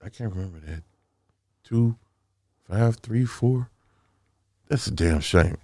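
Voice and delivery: usual speaking voice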